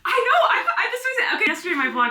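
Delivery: high, loud laughing admission; embarrassed laugh. Real, not acted. Laughing to her friend about yesterday's vlog, high and loud. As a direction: laugh it off, caught out.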